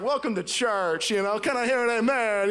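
loud mock Southern preacher; Southern preacher accent. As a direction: call to the congregation in a Southern accent. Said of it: "like, Southern accent".

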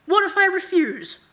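high, loud challenge; defiant. A narrator's reading. Araneth challenges his master's command, defiant. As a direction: dare your master to answer. Araneth defies the night god.

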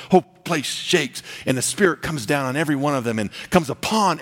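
a revival sermon building in power; full-force preaching. Acted. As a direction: preach it out to the whole church.

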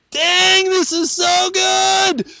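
like tasting fruit for the first time; delighted exclamation. Pretend. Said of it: "you're like".

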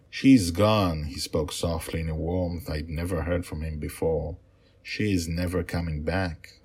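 steady storytelling voice for a bedtime tale; story narration. A narrator's reading. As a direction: read it like a father telling his girls a story. Father reads his story aloud.

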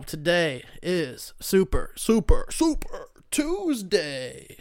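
drawn-out hype-man announcement; mock announcer. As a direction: stretch each word out like an event announcer. Mock announcement of Super Tuesday.

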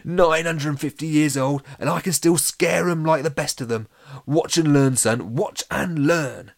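loud, high, boastful ghost; triumphant. A narrator's reading. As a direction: crow over your successful scare. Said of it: "a little triumphant fist pump".

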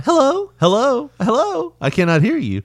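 high, looping automated hellos; glitching phone robot. Retold or imitated. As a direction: imitate a robot that can't hear. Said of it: "she started glitching".

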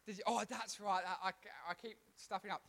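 ordinary talk

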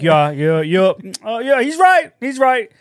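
high-pitched, hammed-up chorus of yeses; mock eager agreement. Acting out the others agreeing.

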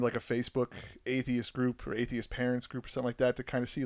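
ordinary talk, nothing marked

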